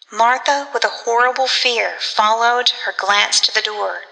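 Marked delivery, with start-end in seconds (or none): none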